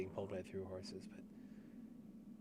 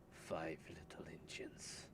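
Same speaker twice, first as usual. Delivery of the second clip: whisper; 4 semitones lower, 8 dB quieter, slower than usual. Acted. Reading a note aloud.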